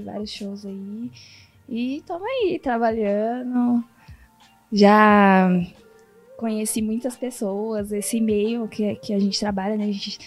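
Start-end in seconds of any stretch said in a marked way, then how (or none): none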